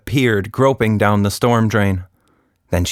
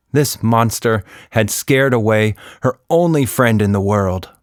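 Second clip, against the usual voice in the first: ordinary delivery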